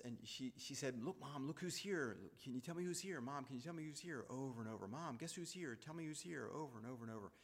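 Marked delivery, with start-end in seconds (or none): 1.0-4.2 high, singsong pestering questions; retold hectoring, pitched up and insistent; imitating a badgering. Sister quizzing sick mom on who's visiting, badgering. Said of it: "badgering her, hectoring her, pestering". 4.9-6.5 high, singsong pestering questions; retold hectoring, pitched up and insistent; imitating a badgering. Sister quizzing sick mom on who's visiting, badgering. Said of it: "badgering her, hectoring her, pestering".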